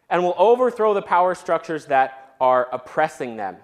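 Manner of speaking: ordinary talk, nothing marked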